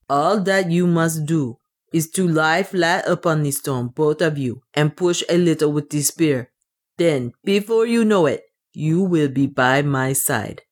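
put-on accented dwarf, deliberate instruction; dwarf servant, confident. The dwarf explains the spear push, assured accented voice. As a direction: coach them as the accented dwarf.

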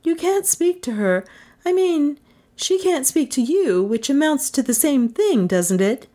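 high-pitched, fumbling character; Melissa's voice. A narrator's reading. Melissa explaining to the caller, high voice. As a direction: chatter on in a high voice.

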